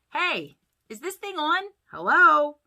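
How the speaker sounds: high, loud comedian's mic check; testing the mic. A narrator's reading. George opening his joke act, testing the mic. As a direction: call into the microphone to see if it works. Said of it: "tapping the microphone".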